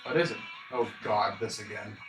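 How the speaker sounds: ordinary talk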